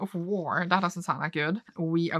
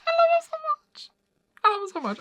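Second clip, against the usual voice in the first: very high, squeaky fangirl gushing; gushing squeal; faster than usual. Real, not acted. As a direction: squeal with adoration, easing slightly. Gushing over the beloved characters.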